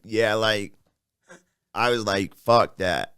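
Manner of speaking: lightly imitated low, soft fighter; Nate Diaz. Fighter giving an interview answer, lightly imitated in low voice. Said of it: "I'll do the Nate Diaz".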